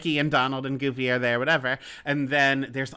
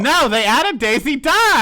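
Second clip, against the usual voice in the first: loud insistence; 10 semitones higher, 8 dB louder than usual. Real, not acted. Insisting the real reason.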